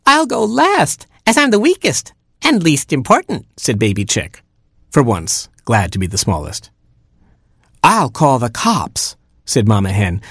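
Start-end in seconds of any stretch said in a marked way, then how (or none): 0.1-3.5 very high squeaky little chick; a tiny baby chick whining. Acted. Baby chick complaining to parents, very high squeak. 7.8-9.2 higher mother hen voice; a fussy mother hen, pitched up; Mama Hen voice. Acted. Mother hen finding her oatmeal eaten, higher voice.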